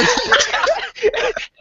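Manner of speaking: real giggle; high laugh. Real, not acted. Laughing at story.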